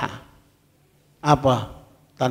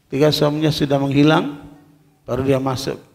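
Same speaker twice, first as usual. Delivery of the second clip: ordinary delivery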